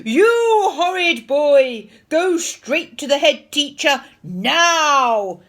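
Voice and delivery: slow, full-volume outraged bellow; furious scream. A narrator's reading. Tricked teacher ordering boy to head teacher, furious scream. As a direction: roar it, stretching the words. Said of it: "screamed Mister Nurden".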